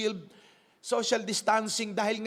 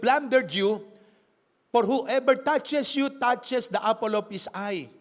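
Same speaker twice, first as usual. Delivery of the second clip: louder, deliberate Bible reading; scripture reading; 5 dB louder than usual. Acted. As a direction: read the verse out to the congregation. Reads scripture aloud.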